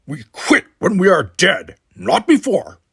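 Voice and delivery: loud, forceful resolve; fierce rallying. A narrator's reading. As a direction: drive it home to rouse him. Worf rallying Simon.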